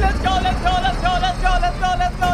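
loud high celebrating; victory cheer. Real, not acted. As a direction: cheer the win, hyped. Celebrating the win.

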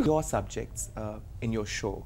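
ordinary talk, nothing marked

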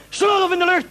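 usual speaking voice

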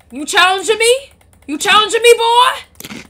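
loud, rising, playful threat; mock challenge. Mother to Junior in a game fight, mock challenge. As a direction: square up with a high, loud question.

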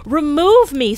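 loud, high, pained cry; anguished exclamation. A narrator's reading. Scrooge begging the spirit to take him away, anguished. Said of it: "Scrooge exclaimed".

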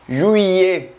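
juillet said zhwee-yay; juillet, the French way; French pronunciation. Shown as a demonstration. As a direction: juillet à la française. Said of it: "Tenemos el mes de julio".

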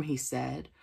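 plain reading voice

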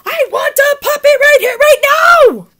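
very high, loud pigeon yell. Acted. Pigeon demanding a puppy now, yelling. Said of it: "these words are big. That's why we're yelling it".

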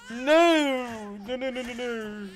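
high, frantic cries of no; panicked scream. Real, not acted. Panicked gamer screaming at scare.

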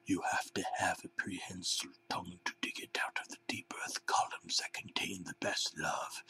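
creepy whisper. A narrator's reading. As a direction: murmur it like a strange prophecy. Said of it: "the head banana said something really weird".

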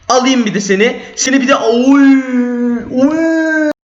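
high excited shout while fighting; sudden high cry; excited yelp. Real, not acted.

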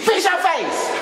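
high laughing parrot; mocking echo. Wife mocking husband's words back at him.

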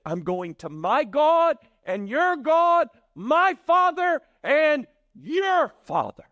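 high, loud, slow declaration; acted jubilant, ringing proclamation; emphatic proclamation. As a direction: punch each phrase, stretching it out loudly. Jesus's climactic declaration to Mary.

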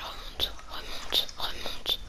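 slow hushed whisper. Acted. Player urging the companion up, in a slow whisper. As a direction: breathe the words so nothing hears.

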